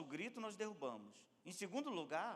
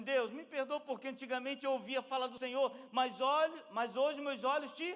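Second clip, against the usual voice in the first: raised, loud plea for pardon; fervent confession; 8 semitones higher, 8 dB louder than usual. Acted. Job asking God's forgiveness, fervent. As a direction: plead with fervour.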